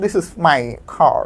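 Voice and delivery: very slow, first syllables leaned on in This is my car; slow sahib-style English. Shown as a demonstration. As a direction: put on airs, stretching out This is my car. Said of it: "পহেলা সিলেবাসে আমি একটু ভাইবিয়ানা ভাব আনতেছি".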